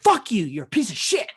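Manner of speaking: retold outburst, loud and high at first; mock angry caller. Caller cursing the worker before hanging up, mildly angry. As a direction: snap into a brief imitation of abuse.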